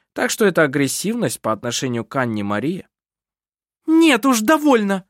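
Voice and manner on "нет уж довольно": high, loud refusal; indignant, raised-pitch exclamation; angry outcry. A narrator's reading. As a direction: cry out that you've had enough. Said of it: "вскричала Керстин и вскочила".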